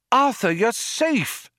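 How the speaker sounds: high, drawn-out cry of relief; cried out. A narrator's reading. Friend greets Arthur on arrival, crying out. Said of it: "a voice cried".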